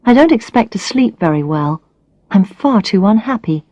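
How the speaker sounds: usual speaking voice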